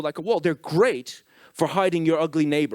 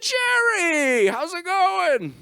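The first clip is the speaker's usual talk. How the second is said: much higher, louder and drawn out slow, sing-song; fake cheery greeting; 6 dB louder, much slower than usual. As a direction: overdo the friendliness to hide suspicion. Boss fakes friendliness with Jerry.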